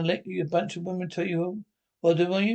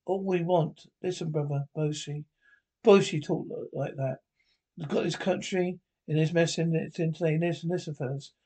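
ordinary delivery

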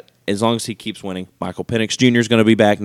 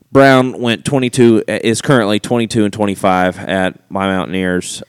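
ordinary talk, nothing marked

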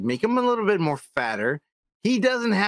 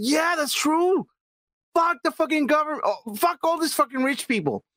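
mock cult follower; 9 semitones higher than usual. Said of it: "He's like".

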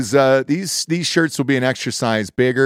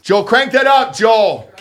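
calling out loud; 10 semitones higher, 5 dB louder, slower than usual. Real, not acted. Calling producer across studio.

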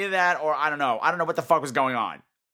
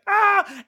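much higher, loud hammed-up scream; mock scream; 5 dB louder than usual. Mocking actors attacked by the baboon, hammed-up scream. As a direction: shriek like a B-movie victim. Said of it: "they're basically like".

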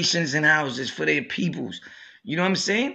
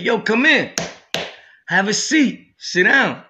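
a louder, higher call to come in and sit; calling out from afar; 5 semitones higher, 4 dB louder than usual. Retold or imitated. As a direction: beckon him from across the cell. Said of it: "He said".

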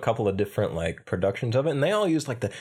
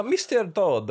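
drawn-out, higher Italian accent; 8 semitones higher, slower than usual. Shown as a demonstration. Barber's rival addressing Mister Todd, Italian accent. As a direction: put on a stagey Italian accent. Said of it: "do his Italian thing first".

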